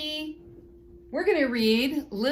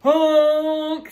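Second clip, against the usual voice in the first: loud honking horn; a bossy dump truck blast; big truck honk; 4 semitones higher, 7 dB louder than usual. Acted. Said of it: "said a dump truck".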